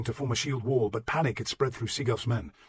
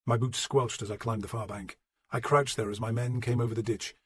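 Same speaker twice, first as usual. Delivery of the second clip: plain reading voice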